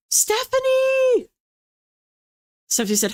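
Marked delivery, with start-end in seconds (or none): 0.1-1.3 high, drawn-out name call; excited call. Real, not acted. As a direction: sing out a friend's name. Greeting a viewer by name.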